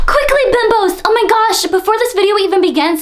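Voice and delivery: high, loud and fast hype; excited rush. Real, not acted. Hurried excited intro.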